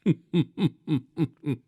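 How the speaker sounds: soft, airy sneering laugh; mocking laugh. Sweeper laughs softly at the shamed merchant. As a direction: chuckle under the breath at a rival. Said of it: "गोरंभ हंसकर द्वारपालों से बोला".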